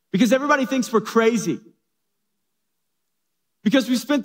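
fed up with the boat; exasperated wife. Acted. Wife complains about neighbors.

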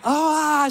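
high, short mock yelp of panic; a quick hammed-up shriek; mock scream. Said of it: "screaming like".

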